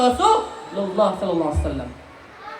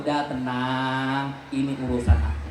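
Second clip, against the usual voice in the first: calm reassurance; 12 semitones lower, 6 dB quieter than usual. Acted. Prophet reassuring worried Jabir, low and calm. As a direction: settle him gently.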